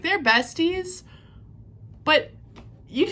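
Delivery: normal talking voice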